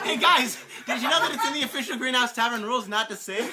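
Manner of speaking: amused rule reminder; laughing scolding. Real, not acted. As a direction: call the group to order while laughing.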